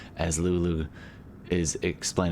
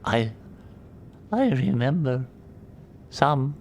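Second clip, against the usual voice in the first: a lightly voiced weak creature, slow and higher, with pauses; weak little voice; 8 semitones higher, slower than usual. Exhausted Lulu speaks telepathically to the party, weak and halting. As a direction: barely get the words out, as if fading. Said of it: "it looks at Darmida".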